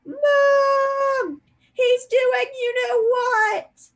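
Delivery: put-on a terrified boy wailing to his mother; howling. A narrator's reading. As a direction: howl it out in terror. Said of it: "howled Dudley".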